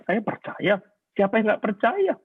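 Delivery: high-pitched defensive protest; retold denial. Husband denying his wife's accusation, in high-pitched protest. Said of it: "Bertahun-tahun saya menyangkali".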